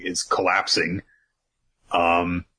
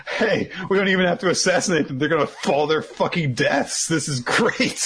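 high, delighted mock excitement; giddy, pitched-up character reaction; gleeful acted quote; 8 semitones higher than usual. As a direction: blurt it high, thrilled by the good luck. Acts out Beige's gleeful reaction.

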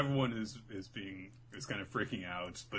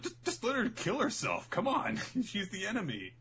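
put-on callous old man; 12 semitones higher than usual. Grandfather urging.

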